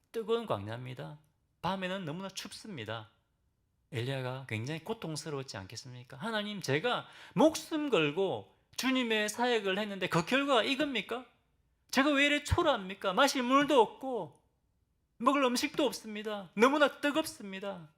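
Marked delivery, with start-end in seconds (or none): 6.2-17.9 high, loud, desperate cry to God; Elijah's anguished plea. Acted. Preacher voicing Elijah crying out to God, high and loud. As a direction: cry out like a broken prophet at the end of his strength.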